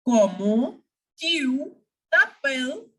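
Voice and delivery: "Comment", "tu", "t'appelles" separated by pauses; word-by-word French demonstration. Shown as a demonstration. Teacher modelling the question for learners, word by word. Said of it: "So it is just comment".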